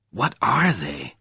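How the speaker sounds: slow, insistent question. A narrator's reading. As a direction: press for an answer, word by word. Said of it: "He insisted".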